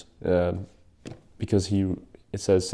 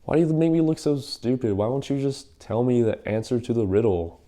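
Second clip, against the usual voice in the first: mock crying; 4 semitones higher than usual. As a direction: imitate a nagging wife's tearful complaint. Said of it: "when she was like".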